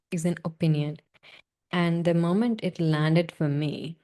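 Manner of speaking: normal talking voice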